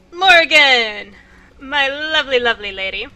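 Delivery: loud, delighted call of a name; excited greeting. Real, not acted. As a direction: greet her with big excitement.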